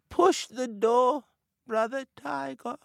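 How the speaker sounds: high, slow, feeble sickbed voice; weak sick voice. Acted. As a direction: fake being too ill to rise. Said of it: "came a weak voice".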